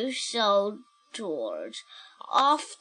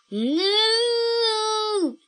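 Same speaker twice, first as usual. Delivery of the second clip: cow moo; 10 semitones higher than usual. Acted. George moos.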